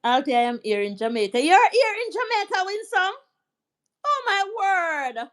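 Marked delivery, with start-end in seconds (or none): 1.4-5.3 high, thrilled disbelief at a viewer's news; surprised delight. Real, not acted. Live host amazed to learn a viewer is in Jamaica, delighted surprise. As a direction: gasp it out as if amazed the viewer is close by. Viewer from her own parish.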